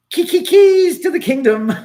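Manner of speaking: high and loud, stumbling through it with glee; a giddy, stammering high exclamation; excited punchline. Real, not acted. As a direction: blurt it out thrilled.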